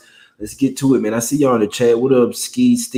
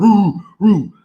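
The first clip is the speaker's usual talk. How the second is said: higher, louder mock howl; howling dog; 7 semitones higher, 5 dB louder than usual. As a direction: howl like at the Timberwolves game. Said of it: "we was howling with dog".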